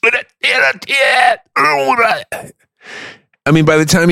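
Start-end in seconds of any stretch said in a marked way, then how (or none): 0.0-2.2 very high, garbled British dialect; hammed-up incomprehensible British voice; mock regional British accent. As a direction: squawk it out in unintelligible northern British. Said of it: "and then you get".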